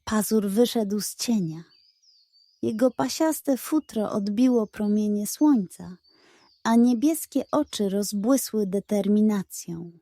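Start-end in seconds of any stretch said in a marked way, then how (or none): none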